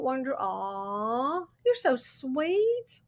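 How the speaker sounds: usual speaking voice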